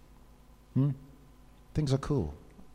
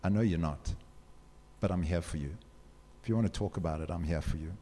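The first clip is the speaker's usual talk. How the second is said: tender comfort; 5 semitones lower, 6 dB quieter, faster than usual. Retold or imitated. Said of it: "hug me tight".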